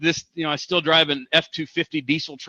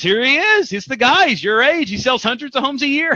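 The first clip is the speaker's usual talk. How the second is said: the broker's voice, much higher and louder, proudly pointing him out; excited broker; 9 semitones higher, 3 dB louder than usual. Retold or imitated. Broker introducing the star agent, excited. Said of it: "She goes".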